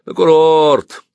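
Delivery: loud, high, drawn-out jeer; mocking heckle from the crowd. A narrator's reading. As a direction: echo it scornfully, stretching the word. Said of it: "послышалось насмешливое".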